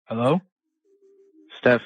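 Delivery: ordinary delivery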